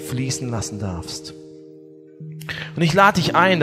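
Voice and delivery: ordinary delivery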